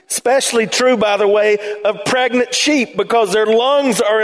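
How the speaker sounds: high, impassioned pulpit voice; fervent preaching. Acted. Pastor preaching to his congregation about stuck sheep, fervent. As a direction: drive the point home to the pews.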